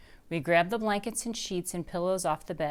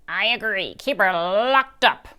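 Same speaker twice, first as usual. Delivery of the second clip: slow, louder grumble with a full mouth; grumbling through popcorn; 2 semitones higher, 7 dB louder than usual. Acted. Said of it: "grumbles Missus Gobblecracker with her mouthful of popcorn".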